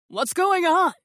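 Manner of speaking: high, loud call from a distance; yelled. Acted. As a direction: shout across the yard. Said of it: "a man yelled".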